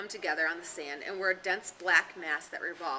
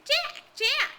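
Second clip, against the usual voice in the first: mocking, much higher echo of the call; bitter mimicry; 10 dB louder than usual. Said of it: "Jack's voice sounded in bitter mimicry".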